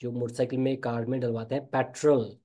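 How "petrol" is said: "पेट्रोल" as PET-rul; correct pronunciation. Shown as a demonstration. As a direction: keep the end of "पेट्रोल" weak. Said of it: "पेट्रोल नहीं". ("पेट्रोल" को पैट्रल बोलें)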